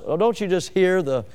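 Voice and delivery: normal talking voice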